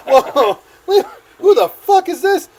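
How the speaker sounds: high, loud, startled demand; indignant outburst. Retold or imitated. Reacting to the phone call, high and loud. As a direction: demand it in alarmed disbelief.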